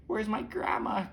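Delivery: high, mock-tearful little girl; acted tearful plea; crying. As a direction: wail it a little, pitched well up.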